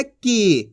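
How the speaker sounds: की with the ī held long; की said as a long, sustained "kee"; long drawn-out vowel. Shown as a demonstration. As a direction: stretch the vowel in की. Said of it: "खींच के पढ़ा जाता है".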